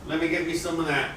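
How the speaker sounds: normal talking voice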